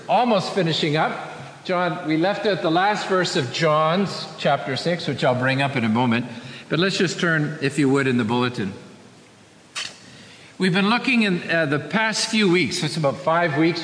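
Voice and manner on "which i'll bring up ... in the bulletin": normal talking voice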